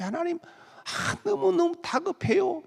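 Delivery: half-comic frantic begging to God; mock desperate whine. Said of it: "이러는데".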